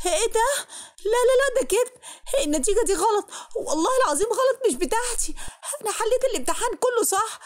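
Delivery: high, frantic shrieking denial; hysterical breakdown. Acted. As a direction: wail in disbelief at a failing grade. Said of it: "انهارت وبقيت أصوت".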